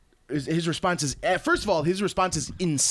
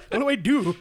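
high mock-helpless plea; pitched-up comic panic; hapless player; 9 semitones higher, 4 dB louder than usual. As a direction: plead as the bewildered newcomer. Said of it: "He's like".

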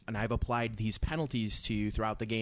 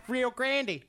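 mock squeal; 13 semitones higher than usual. Mocking a game company name.

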